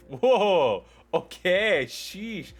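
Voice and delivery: high, loud and slow, hands-up defensive whine; loud defensive protest. Acted. Joey snaps back at the group, loud and defensive. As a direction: protest loudly that you were only asking. Character defends himself loudly.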